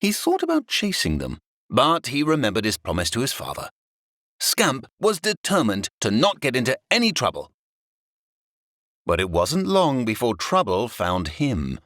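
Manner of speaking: usual speaking voice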